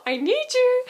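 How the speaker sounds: high, loud dramatic call; calling for Hugo. Retold or imitated. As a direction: wail his name across the castle. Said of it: "you'll hear".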